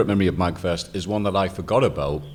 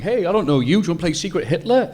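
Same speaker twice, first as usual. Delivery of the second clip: eager invitation; 10 semitones higher, 3 dB louder than usual. Retold or imitated. Inviting strangers to play.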